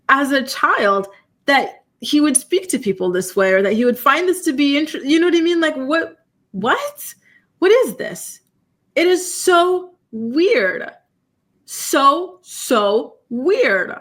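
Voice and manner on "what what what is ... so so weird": high, slow, drawn-out disgust; disbelief. Real, not acted. Streamer reacting to abusive texts, high, slow disgusted disbelief. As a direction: let the disbelief stretch out.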